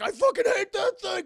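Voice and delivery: acted-out angry shout, high and loud; angry outburst. Retelling Cold Stone hitting the device, angry yell. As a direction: yell it as a furious character would.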